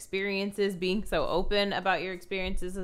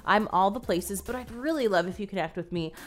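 upbeat, higher-pitched host sign-off; podcast outro read; 4 semitones higher than usual. Acted. Host sends listeners to the show notes, bright podcast outro read.

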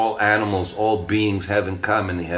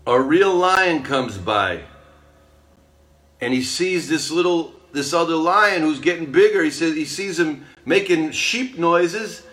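higher, bright-paced fable telling to a listener; animated storytelling; 5 semitones higher than usual. A narrator's reading. Spiritual teacher telling a fable to a friend, animated storytelling. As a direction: tell it like a wide-eyed parable.

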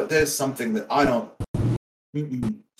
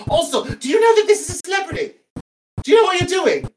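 rising rant; 7 semitones higher than usual. Acted. Ranting at Bears, voice climbing.

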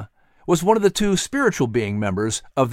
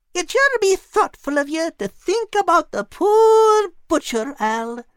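much higher, sarcastic teasing brogue. Acted. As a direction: needle the greedy cat dryly. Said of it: "she remarked in a sarcastic tone".

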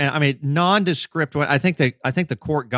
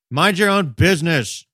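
higher, mildly put-on telling-off; retold brusque dismissal; 5 semitones higher, 4 dB louder than usual. Officer to people calling out, retold as brusque dismissal. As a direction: brush the bystander off, imitating a curt officer. Acting officer brushing off bystanders.

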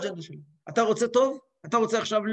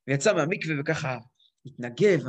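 normal talking voice

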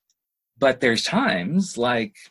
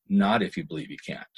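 quiet emphasis; 8 semitones lower, 5 dB quieter, faster than usual. Real, not acted. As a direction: lean in and whisper the warning. Dropped to emphatic near-whisper.

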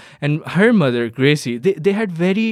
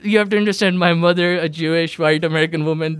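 ordinary talk

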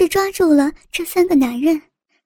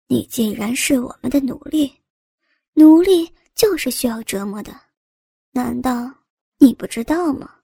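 sinister; 3 semitones lower than usual. Acted. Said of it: "阴险地笑着说道".

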